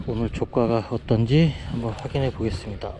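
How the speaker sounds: ordinary delivery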